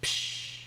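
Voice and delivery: sharp breathy psh; cymbal crash. Shown as a demonstration. Teacher imitates a cymbal crash for students, a sharp psh. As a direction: spit out a crash. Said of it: "or just a crash".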